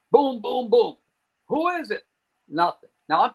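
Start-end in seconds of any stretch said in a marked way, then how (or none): 0.1-1.0 high, punchy booms; imitated door knocks. Sudden knock interrupts men having a beer, pounding booms. 1.5-2.0 very high imitation of calling through a door; retold call-out. Dealer calls to the silent knocker, high mock yell.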